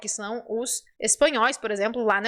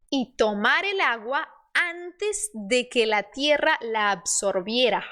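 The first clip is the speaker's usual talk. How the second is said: slow, measured Spanish story reading for learners; slow model reading; slower than usual. Shown as a demonstration. Teacher reading the crow fable to learners, slow model reading. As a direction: read each phrase carefully, spacing the words.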